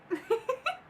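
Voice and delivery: high burst of giggling; giggle. Real, not acted. About to read a funny post, a high giggle. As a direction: let a high giggle escape.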